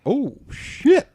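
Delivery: high, drawn-out "oh shit"; retold starstruck surprise; awed reaction. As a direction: act out a stunned realization. Retold awestruck reaction to sighting.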